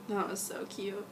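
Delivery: ordinary delivery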